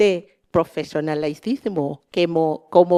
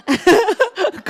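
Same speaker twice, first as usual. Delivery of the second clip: embarrassed laugh; 11 semitones higher than usual. Real, not acted. Laughs at own Spanish.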